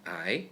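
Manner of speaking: I rising upward, second tone. Shown as a demonstration.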